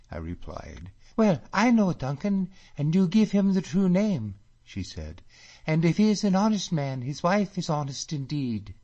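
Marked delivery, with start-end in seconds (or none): 1.2-4.4 high, loud eager lady's voice; girl thrilled he knows Duncan; delighted. A narrator's reading. Catriona delighted. 5.7-8.7 high, loud eager lady's voice; girl thrilled he knows Duncan; delighted. A narrator's reading. Catriona delighted.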